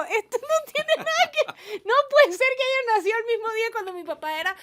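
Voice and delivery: mock disbelief. Telling Carlos he can't share her dad's birthday, high incredulous exclaim. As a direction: squeal in comic disbelief.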